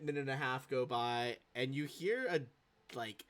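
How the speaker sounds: normal talking voice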